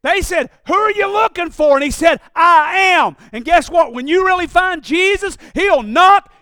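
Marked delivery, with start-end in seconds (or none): 0.7-1.7 shouted, higher demand; loud challenge. Retold or imitated. Soldiers' challenge in sermon. 2.3-3.2 booming shout; Jesus shouting his name back at the soldiers; loud declaration. Retold or imitated. Jesus answer at full force.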